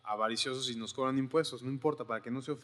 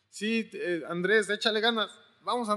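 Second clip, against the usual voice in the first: higher, louder, hammed-up pep talk; mock cheerleading; 8 semitones higher, 4 dB louder than usual. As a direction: cheer Andrés on like a coach.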